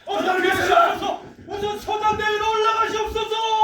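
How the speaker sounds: high, loud pleading to flee; urgent shouting. Acted. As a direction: beg the king to run, loudly. Urging king to flee bombardment.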